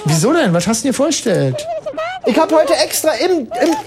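flirting with Silvia on the phone, sugary.

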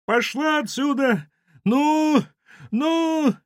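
very high, drawn-out shrieks; high-pitched panicked shooing; frantic screaming. Acted. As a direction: shriek to drive something away. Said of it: "закричали истошно".

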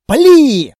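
loud, high, cracking scream; hysterical shout. Acted. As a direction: shriek the order in panic. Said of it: "Голос эльфа сорвался в истеричные нотки".

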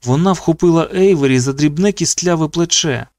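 plain reading voice

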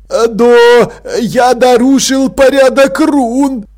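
high, loud, drawn-out whine; acted tearful whimper. A narrator's reading. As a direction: wail it like a sniffling boy. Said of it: "прохныкал Артур".

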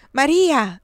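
loud, high call for help. A narrator's reading. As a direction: shout the name urgently.

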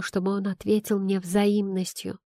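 usual speaking voice

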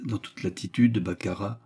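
ordinary delivery

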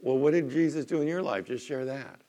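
usual speaking voice

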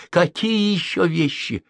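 annoyed disbelief; angry. A narrator's reading. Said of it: "разозлился я". (раздражённо)

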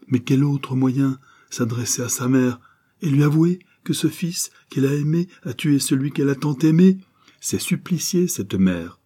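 horrified pleading questions; anguished outburst. Acted. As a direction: let the horror swell.

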